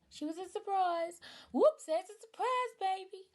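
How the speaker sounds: high, bright and playful; playful surprise. Real, not acted. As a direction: tease it like a happy accident.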